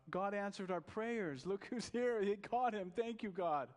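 high, loud, joyful outcry; wife rejoicing as police bring him in, crying out. Acted. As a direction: shout thanks to heaven. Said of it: "she cries out".

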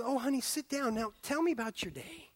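soft, tender invitation; gentle wife. Retold or imitated. Preacher voicing a wife welcoming her husband home, soft and tender. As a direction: coax him to sit and talk. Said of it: "But the woman says".

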